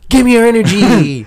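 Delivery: high, loud demanding alien; booming alien demand. Pretend. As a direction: roar it like a greedy alien. Aliens come demanding energy.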